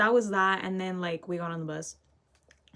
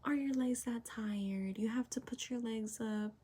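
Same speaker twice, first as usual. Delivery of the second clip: unvoiced, slow sarcastic whisper; 11 dB quieter than usual. Real, not acted. Teen mocks the lady to viewers, sarcastic whisper. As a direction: mutter the jab under your breath. Muttering about the rude lady.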